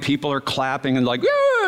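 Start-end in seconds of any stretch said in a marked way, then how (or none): 1.2-1.7 high cheering "yeah"; mock cheer. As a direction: imitate the crowd's delighted cheer. Crowd cheering at fireworks.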